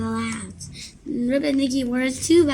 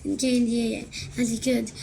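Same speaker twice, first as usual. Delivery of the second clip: ordinary delivery, nothing marked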